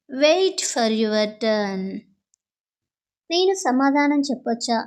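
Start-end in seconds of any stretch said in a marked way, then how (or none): 0.1-1.8 very slow, clear classroom English; slow model phrase. Shown as a demonstration. Teacher modelling the phrase for Telugu students, slow and clear. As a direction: spell it out slowly for learners. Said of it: "మీ వంతు కోసం వేచి ఉండండి".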